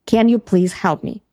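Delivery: usual speaking voice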